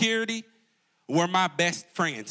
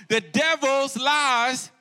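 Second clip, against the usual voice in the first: drawn-out preaching; 6 semitones higher, 3 dB louder, much slower than usual. Acted. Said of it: "preaching emphasis".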